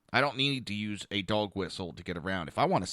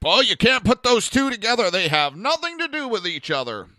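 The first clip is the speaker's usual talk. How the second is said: high, loud sarcastic imitation of liberals; mocking mimicry; 10 semitones higher, 8 dB louder than usual. Mimicking gun-control advocates, high and loud mockery. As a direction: parrot them with scorn.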